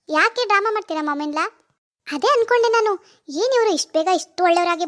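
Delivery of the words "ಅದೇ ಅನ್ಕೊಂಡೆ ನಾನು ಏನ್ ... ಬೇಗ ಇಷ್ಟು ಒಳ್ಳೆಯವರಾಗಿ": sharp accusation. Acted. Confronting mother-in-law.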